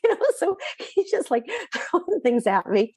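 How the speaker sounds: amused, giggling as she tells it; laughing. Real, not acted. Laughing at boss's offers.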